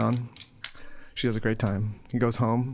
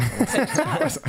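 laughing denial; 12 semitones higher than usual. Real, not acted. Joking denial of being a rock star.

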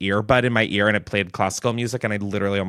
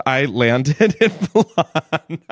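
slow, giggling through the words; can't keep a straight face; laughing; 5 semitones higher, slower than usual. Real, not acted. Laughing through her own story.

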